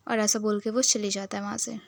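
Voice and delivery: ordinary talk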